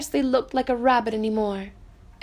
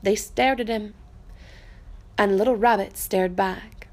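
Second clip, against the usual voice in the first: ordinary delivery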